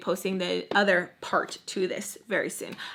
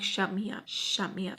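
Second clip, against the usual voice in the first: retold quiet dare; 2 semitones lower, 7 dB quieter than usual. Nanny facing down her rival, quiet retold dare. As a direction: dare her under your voice.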